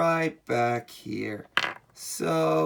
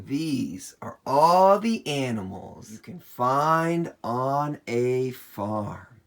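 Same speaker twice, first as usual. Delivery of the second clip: ordinary talk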